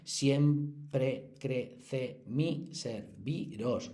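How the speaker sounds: very slow, syllable by syllable; counting syllables. Shown as a demonstration. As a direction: tap out each syllable of "siempre crece mi serviros". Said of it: "Eh, perdón". (silabeando muy despacio "siempre crece mi serviros")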